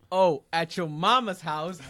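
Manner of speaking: mock announcing the joke to the crowd; said loud enough. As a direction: project it so everyone hears. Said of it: "I'll say it loud enough".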